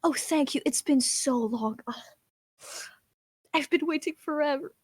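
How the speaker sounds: fancy put-on lady. Noblewoman thanking the dwarf in Dwarvish, fancy. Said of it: "she sounds fancy in, in Dwarves".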